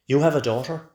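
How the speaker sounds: loud, high-pitched incredulous demand; shouted question. A narrator's reading. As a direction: raise your voice sharply on the question. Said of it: "he shouts".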